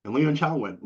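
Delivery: ordinary talk, nothing marked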